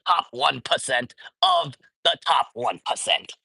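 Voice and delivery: a hint of a fiery old senator grilling a CEO; mock Bernie grilling a billionaire; Bernie Sanders voice. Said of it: "let me put on my Bernie Sanders voice".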